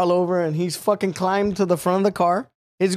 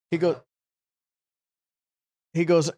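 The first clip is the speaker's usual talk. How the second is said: ordinary talk, nothing marked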